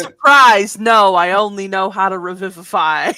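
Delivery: loud, laughing "surprise" reveal; mock announcement. Player revealing Snips only has one spell, loud mock announcement. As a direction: blurt it out loudly as a joke.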